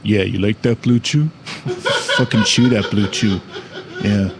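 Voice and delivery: low, laughing mock seductive growl; sexy voice. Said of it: "if my sexy voice is like".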